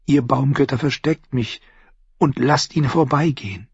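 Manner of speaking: high, urgent little prayer; praying. A narrator's reading. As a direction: beg the gods to hide you. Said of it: "betete sie".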